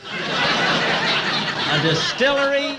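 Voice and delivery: slow, high disbelieving echo; incredulous. Acted. Jack echoing Bob's odd claim, slow high disbelief. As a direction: drag the word out in disbelief.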